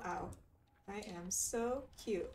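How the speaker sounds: usual speaking voice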